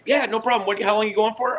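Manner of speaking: high, light, easygoing officer voice; imitating a relaxed guard waving him through; breezy US border guard. To a Canadian traveller, the US guard sounds high and breezy.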